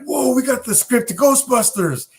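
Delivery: retelling the sitcom guys finding the script, excited; mock excitement. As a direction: play the guys' big discovery. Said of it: "and they're like".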